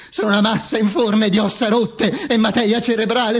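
high declaiming, slowing at the end; building tirade. Acted. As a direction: build to a high, stretched final cry. (declamazione acuta in crescendo)

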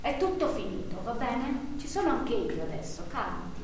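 put-on calm him down like a frightened child; soothing reassurance. Wife soothes husband after shouting. (rassicurante, dolce e sommesso)

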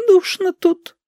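very high, innocent granny voice; high, butter-wouldn't-melt old lady; angelic little voice. A narrator's reading. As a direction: play innocent in a tiny angelic voice. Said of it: "ангельский голосок".

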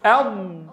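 "أض" with the air held between tongue and palate; correct ض. Shown as a demonstration. Teacher modeling the correct "أض" for students. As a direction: press "أض" firmly, no nasal hum. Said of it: "واحصر الصوت بين اللسان والحنك الأعلى".

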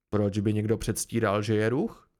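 low, puzzled question; mayor's deep-pitched bafflement; uncomprehending. A narrator's reading. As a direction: ask as if it made no sense at all. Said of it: "jako by mu to připadalo nepochopitelné".

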